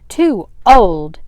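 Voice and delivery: loud, indignant echo of an insult; angry. A narrator's reading. Aunt turning on Sarah over her age, angry. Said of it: "Aunt Willie turned to her angrily".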